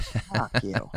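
muttered retort. Retold or imitated. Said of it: "You're like".

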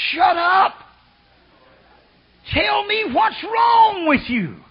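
high, loud and slow; stern loud command. Retold or imitated. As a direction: order her to stop hollering. Preacher retells scolding.

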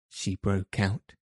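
ordinary delivery, nothing marked

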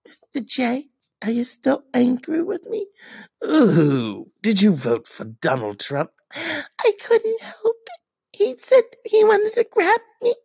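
very high, whiny Harley voice, crying; Harley Quinn. Acted. Harley whining to Mister J, very high and tearful. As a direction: whimper like a needy Harley.

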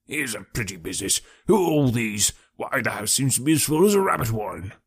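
blustering, husky character voice; muffled husky policeman. A narrator's reading. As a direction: grumble it out through a thick, muffled throat. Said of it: "he cried in a muffled, husky voice".

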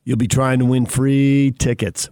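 usual speaking voice

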